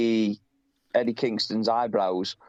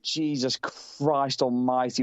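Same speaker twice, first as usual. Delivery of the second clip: usual speaking voice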